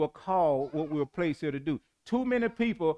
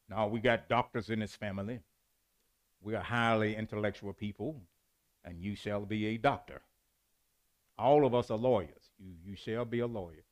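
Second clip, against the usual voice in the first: low, quiet, lightly acted family pronouncement; hint of a lofty parent decreeing in a lowered, softer voice; pompous parent; 7 semitones lower, 6 dB quieter than usual. Parent dictating a child's career, lightly acted pomposity.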